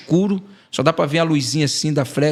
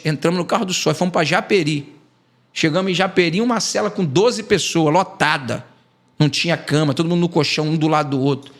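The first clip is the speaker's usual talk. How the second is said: ordinary delivery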